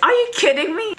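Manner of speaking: very high, loud mock outrage. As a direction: let the voice shoot up, indignant. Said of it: "my voice just raised".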